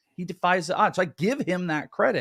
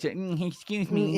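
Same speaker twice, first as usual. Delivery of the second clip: slow nasal-free nerdy prim voice; prissy nerd asking politely; 4 semitones higher, 3 dB quieter, slower than usual. Pretend. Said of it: "like your nerd voice".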